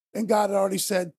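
ordinary delivery

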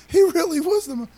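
the penny drops and it spills out; excited realisation. Retold or imitated. Realising Jesus was Messiah.

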